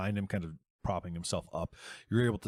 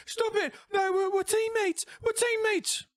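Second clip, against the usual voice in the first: very high, loud pleading; terrified scream; 6 dB louder than usual. Acted. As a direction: scream for mercy. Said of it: "he falls and he says".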